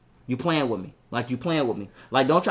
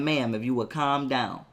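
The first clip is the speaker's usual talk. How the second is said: calm; 6 semitones lower, 6 dB quieter than usual. Retold or imitated. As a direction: keep it low and measured to settle her down. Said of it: "trying to be calm with her mother".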